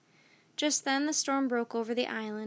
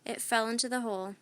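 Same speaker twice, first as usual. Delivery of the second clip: ordinary delivery, nothing marked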